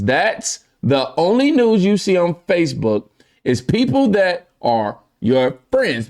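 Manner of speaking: high, deliberate delivery; exaggerated rereading. Real, not acted. Host rereading the stumbled comment, high and overdone.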